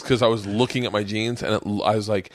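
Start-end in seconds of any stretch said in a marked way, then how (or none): none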